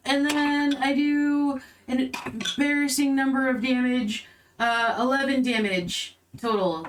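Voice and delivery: normal talking voice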